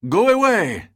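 high, loud shout; yelling. A narrator's reading. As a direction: bellow it at the helicopter. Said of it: "she yelled".

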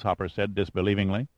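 usual speaking voice

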